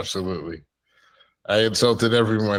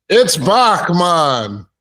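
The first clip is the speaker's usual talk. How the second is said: slow, loud, higher comic shout; loud callout; 6 semitones higher, 7 dB louder, much slower than usual. Acted. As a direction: belt it out slowly.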